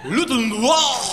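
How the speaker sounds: high, mumbled fake French; mock French mumbling. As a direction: swallow the sounds in fake French. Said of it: "French is like".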